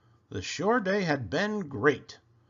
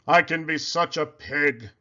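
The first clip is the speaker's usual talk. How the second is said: Poppleton voice; 5 dB louder than usual. A narrator's reading. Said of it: "he said".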